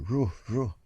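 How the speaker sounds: two short woofs; imitated barking. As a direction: bark like a dog. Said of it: "we heard some barking".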